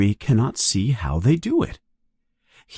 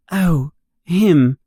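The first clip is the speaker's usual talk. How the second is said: embarrassed; 5 semitones higher, 3 dB louder than usual. A narrator's reading. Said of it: "feeling embarrassed".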